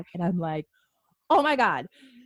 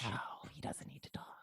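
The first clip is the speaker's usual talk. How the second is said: breathy whispered aside; hushed conspiratorial whisper; 10 semitones lower, 20 dB quieter than usual. Real, not acted. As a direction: drop to a whisper for a cheeky aside.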